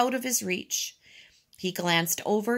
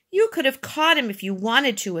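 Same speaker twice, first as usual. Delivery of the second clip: higher-pitched reproach; mildly indignant accusation; accusing; 6 semitones higher, 3 dB louder than usual. A narrator's reading. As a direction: blame your friend. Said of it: "accused Robert".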